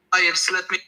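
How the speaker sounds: ordinary talk, nothing marked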